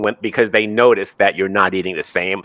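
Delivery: usual speaking voice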